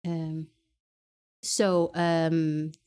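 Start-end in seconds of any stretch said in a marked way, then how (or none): none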